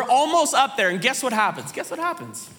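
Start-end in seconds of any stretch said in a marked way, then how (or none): 1.7-2.5 much softer, higher repeat; soft, teasing repeat; hushed suspense. Real, not acted. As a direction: drop to a hush to build suspense.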